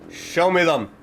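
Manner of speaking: usual speaking voice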